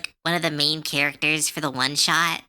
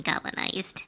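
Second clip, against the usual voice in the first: whispered; 6 dB quieter than usual. Real, not acted. Trailing, whispered word.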